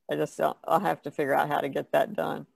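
normal talking voice

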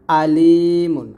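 আলিমুন with plain glottal 'a', loud long লি; hamza version. Shown as a demonstration. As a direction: open আলিমুন on a clean hamza and stretch the ee. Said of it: "এখানে কিন্তু আলিমুন বলা যাবে না".

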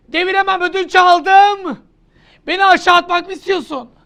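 loud, high taunting shouts; mock-aggressive yell. Teacher daring the tired student to shout at him. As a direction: dare them to fight back, hammed up.

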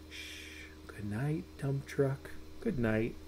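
soft lullaby whisper; hushed goodnight. Acted. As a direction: hush it like a sleeping child is near. Bedtime refrain hushed to sleep.

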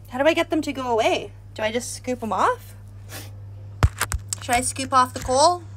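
ordinary talk, nothing marked

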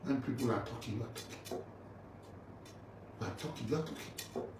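hushed mocking whisper under the breath; whispering scorn at a man who talks; whispered mockery. Real, not acted.

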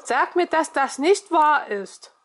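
lightly voiced ghostly stranger; the voice in her head. A narrator's reading. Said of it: "hörte ich die Stimme".

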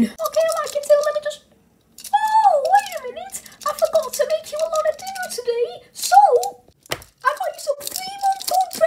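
very high, squeaky, flustered voice; squeaky wife. Acted. Wife offers husband stale bread for dinner, squeaky. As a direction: squeak like an eager, forgetful wife.

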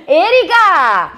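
a long, high, loud "Erika" at half speed; delighted Erika. Real, not acted. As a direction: stretch the name out with joy. Greeting the commenter with delight.

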